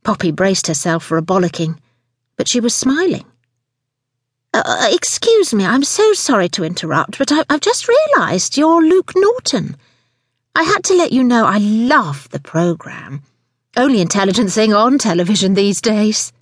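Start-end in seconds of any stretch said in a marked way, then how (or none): 4.5-16.3 high, simpering admiration; gushing fan. Acted. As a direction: fawn over a television star. Gushing fan interrupts a celebrity.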